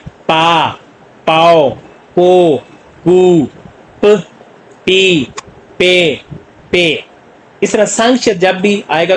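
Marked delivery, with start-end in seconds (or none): 0.1-7.0 slow, clearly sounded Korean syllables पा पाओ पो पू प पी पे; teacher sounding out पा पाओ पो पू; syllable drill. Shown as a demonstration. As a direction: drill each vowel after p, one by one. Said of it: "Urdu में ये पे".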